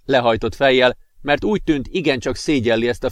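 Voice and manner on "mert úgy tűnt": usual speaking voice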